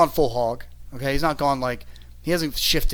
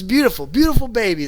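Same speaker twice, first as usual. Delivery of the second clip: lightly voiced baby-kissing politician, higher and louder; 8 semitones higher, 5 dB louder than usual. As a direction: gush like a governor at a rally. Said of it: "like he's a politician".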